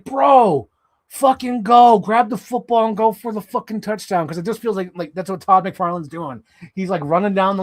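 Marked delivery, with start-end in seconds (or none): 0.0-4.3 louder and higher, urging him on like a fan; excited fan shouting encouragement; hyped cheering. Retold or imitated. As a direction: yell at him to go score. Cheering McFarlane on.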